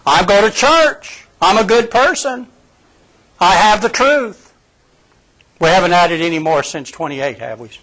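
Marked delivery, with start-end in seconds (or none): 0.1-4.5 higher, self-satisfied mock voice; smug churchgoer. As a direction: sneer it like a smug believer.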